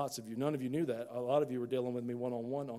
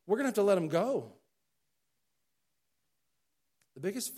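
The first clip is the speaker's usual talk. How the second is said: prophet voice; 6 semitones higher, 5 dB louder than usual. Acted. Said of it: "I sound like a prophet up here".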